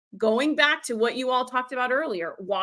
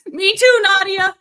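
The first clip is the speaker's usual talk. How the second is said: higher, louder laughing reply; 7 semitones higher, 8 dB louder, slower than usual. Real, not acted. Laughing along with Nadia's graveyard comment. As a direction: laugh it out in real amusement.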